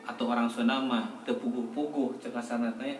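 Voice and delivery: ordinary talk, nothing marked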